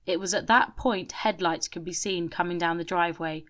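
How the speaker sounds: ordinary delivery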